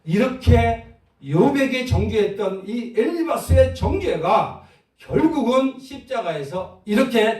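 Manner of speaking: high, loud and slow, hammering each word; preaching loud. Real, not acted. Preacher to his congregation about the cross, loud and slow. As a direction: drive the point home from the pulpit.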